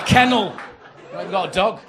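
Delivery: high, very loud exasperated yell; exasperated shout. Acted. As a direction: yell it as the absurd solution. Yelled answer in acted row.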